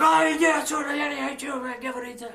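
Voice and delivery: high, loud mock brush-off from the officer; mocking cop. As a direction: play the cop waving him off with a high shout. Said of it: "He's like".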